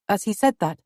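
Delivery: usual speaking voice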